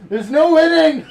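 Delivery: a high, loud cry through laughter; laughing shout. Real, not acted. Game master losing to the players' jokes, laughing shout. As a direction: shout it out while cracking up.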